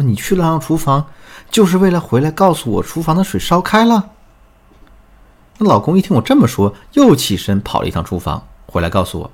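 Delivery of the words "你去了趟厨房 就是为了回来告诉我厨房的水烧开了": annoyed retort. Retold or imitated. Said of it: "我很生气啊".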